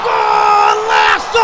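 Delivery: roaring goal call; goal shout. Real, not acted.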